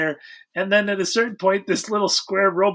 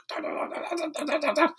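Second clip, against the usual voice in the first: robotic babble; 9 semitones higher, 5 dB quieter than usual. Retold or imitated. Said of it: "and he's like".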